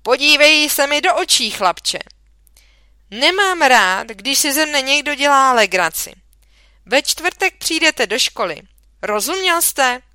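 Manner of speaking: angry shout. A narrator's reading. Teacher yelling at a boy he thinks is mocking him, furious shout. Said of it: "rozkřikl se na mne".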